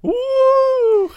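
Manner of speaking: high loud cheer. Real, not acted. Friend cheering the pregnancy reveal, a high whoop. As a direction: whoop for joy at the news.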